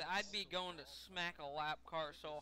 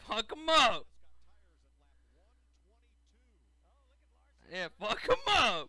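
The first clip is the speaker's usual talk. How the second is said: yelled encouragement, much louder; loud cheering; 10 dB louder than usual. Real, not acted. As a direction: egg the driver on at full volume. Urging a driver to retaliate.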